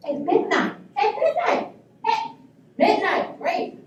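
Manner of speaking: high, rising emphasis on midnight; mock outrage, pitch jumping up; scandalized. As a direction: repeat it in high-pitched disbelief. Indignant repeat of the late hour.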